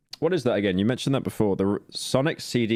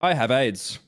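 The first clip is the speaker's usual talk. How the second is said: ordinary talk, nothing marked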